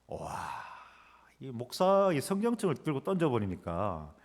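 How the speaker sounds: ordinary talk, nothing marked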